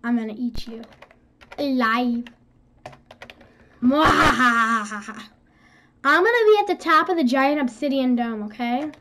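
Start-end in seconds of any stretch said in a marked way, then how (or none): 0.0-5.2 hammed-up evil cackle. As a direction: play a cartoon monster gloating. Mock threat then cackle.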